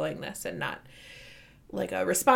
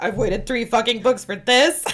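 loud disbelief, rising on THIS; indignant question with THIS punched upward; incredulous letdown; 4 semitones higher, 7 dB louder than usual. Retold or imitated. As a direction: demand it in disbelief, louder than usual. Acts out second, let-down reaction.

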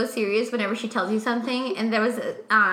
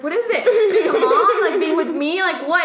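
high, louder alarmed questions; worried husband; 7 semitones higher, 7 dB louder than usual. Retold or imitated. Sponsor Daddy asking his wife, high and alarmed. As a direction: rush to ask what's wrong.

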